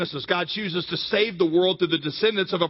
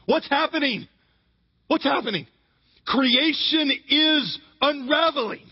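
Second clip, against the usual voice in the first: urgent preaching; 6 semitones higher than usual. Real, not acted. Preacher building intensity.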